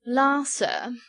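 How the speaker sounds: drawn-out mock wonder; wonderment. A narrator's reading. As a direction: exclaim like an amazed dandy. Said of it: "with undisguised wonderment".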